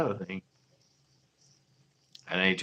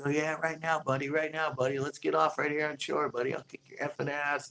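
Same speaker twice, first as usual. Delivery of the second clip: higher, taunting fisherman picking a fight; hammed-up angry angler daring a brawl; mock tough-guy challenge; 6 semitones higher than usual. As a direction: taunt him to fight on shore. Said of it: "the other guy went back at him".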